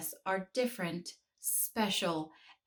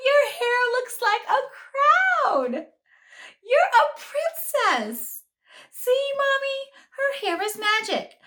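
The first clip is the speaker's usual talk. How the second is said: put-on amazed little girl; 8 dB louder than usual. A narrator's reading. Jenny amazed by Sophia's hair.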